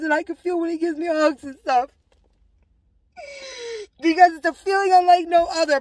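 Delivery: real high, tearful voice. Real, not acted. Woman tells of feeling her husband near, tearful. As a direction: choke up, pitch rising as you go. Moved while describing his presence.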